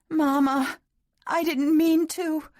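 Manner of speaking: put-on high, loud little-girl voice, slowing; childlike wail. A narrator's reading. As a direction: quail like a frightened small child. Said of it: "she quailed in that same childlike voice".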